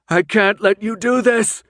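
high, loud desperate yell; frantic protest yelled out. Acted. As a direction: yell against the others. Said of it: "Thomas yelled".

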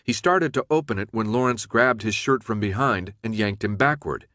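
ordinary delivery, nothing marked

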